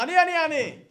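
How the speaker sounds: high, loud, repeated outburst; eager volunteering shout. Acted. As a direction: claim it eagerly before anyone else can. God volunteers to buy him.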